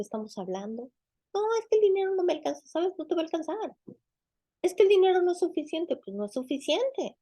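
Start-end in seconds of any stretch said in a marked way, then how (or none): 1.3-2.6 mimicked complaint, higher and fast; whiny excuse. Preacher mocking a believer who complains about money, whiny and fast. 4.6-6.0 mimicked complaint, higher and a little fast; whiny excuse. Preacher imitating a believer's money excuse, higher and whiny.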